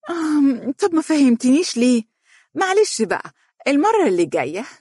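high-pitched coy wife's voice; sly. A narrator's reading. Wife feigning ignorance to her husband, sly and high. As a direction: play innocent slyly, pitched well up. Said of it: "قالت بمكر".

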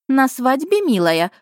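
high chirpy twitter; cartoon bird voice. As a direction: chirp it sweetly, pitched high. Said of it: "прощебетала Александрия Быстрая".

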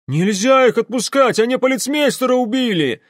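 high, loud urgent yell; alarmed protest. Acted. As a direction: soldier shouting to stop an escape. Soldier protests the fugitives leaving. (возмущённый тревожный крик)

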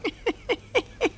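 high thin crone's cackle; old crone cackle. Acted. Old woman cackles at the boy's courage, high cackle. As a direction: cackle like a sly old witch.

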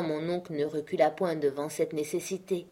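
usual speaking voice

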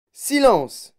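loud, high command; ordre sec. A narrator's reading. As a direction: call sharply for silence. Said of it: "dit monsieur Wonka". (ordre lancé d'une voix forte et aiguë)

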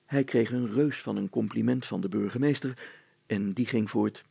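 ordinary delivery

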